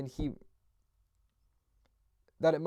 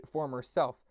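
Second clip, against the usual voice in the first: plain reading voice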